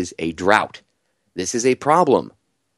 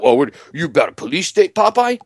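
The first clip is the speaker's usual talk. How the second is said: mocking critic voice; 5 semitones higher than usual.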